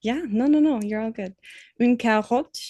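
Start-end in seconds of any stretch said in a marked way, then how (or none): 1.8-2.6 "Une carotte" with a throaty French R; French pronunciation. Shown as a demonstration. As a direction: say "Une carotte" with a guttural R in the middle. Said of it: "please, everybody".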